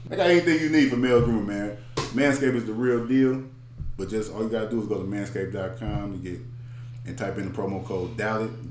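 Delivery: ad read. Acted.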